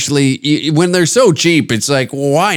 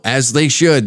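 ordinary talk, nothing marked

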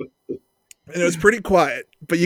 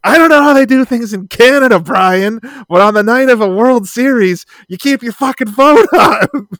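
higher, louder scolding New Yorker; Elliot's condescending voicemail; 5 semitones higher, 6 dB louder than usual. Retold or imitated. Friend scolds Brian by voicemail for phone off, higher louder condescending. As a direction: lecture him like a smug local, loud and high. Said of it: "I get this voicemail from Elliot".

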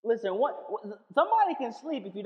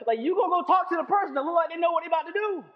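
1 preaching shout; 9 semitones higher, 6 dB louder, faster than usual. Real, not acted. Preacher exhorting congregation loudly.

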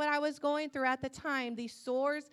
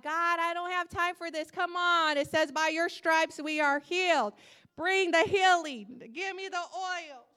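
louder, higher impatient plea; demanding God; 4 semitones higher, 5 dB louder than usual. Retold or imitated. Sick woman tells God to hurry healing, loud insistence. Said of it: "I'm trying to tell God".

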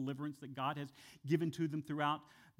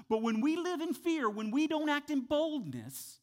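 preaching climax; 9 semitones higher, 5 dB louder than usual. Real, not acted. Preaching at full force.

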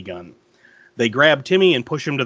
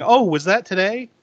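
mock-innocent question, pitched up; higher, put-on surprise; feigned ignorance; 6 semitones higher, 3 dB louder than usual. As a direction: imitate someone playing dumb. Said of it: "Bill feigns ignorance, saying".

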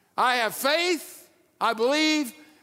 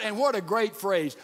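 ordinary talk, nothing marked

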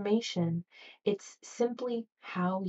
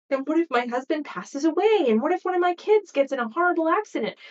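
high, loud, panicky what-ifs; anxious spiral; 7 semitones higher, 6 dB louder than usual. Retold or imitated. Worrier panicking about bills and family, mock fretful. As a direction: spiral into worry, faster and higher.

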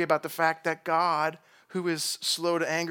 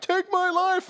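much higher, pleading mock whine; whiny Jonah mockery; 4 dB louder, slower than usual. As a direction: squeak out Jonah's dramatic death wish. Jonah's death wish mocked.

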